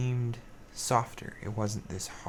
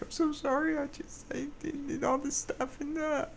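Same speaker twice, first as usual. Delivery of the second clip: much higher, put-on sorry voice; profuse apology. Apologizing to coworkers after blowing up, a high gushing sorry voice. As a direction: gush the apology in a tiny high voice. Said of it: "I apologized profusely afterwards".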